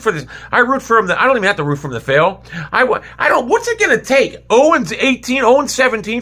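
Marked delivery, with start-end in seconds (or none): 2.7-6.2 exasperated outburst. Real, not acted. Exasperated fan rant.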